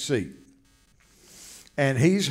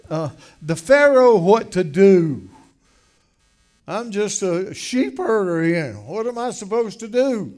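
louder, higher pleading objection; acted protest; 5 semitones higher, 6 dB louder than usual. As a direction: protest the assignment, voice raised in disbelief. Moses protesting his mission to God.

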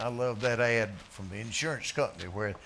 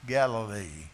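ordinary talk

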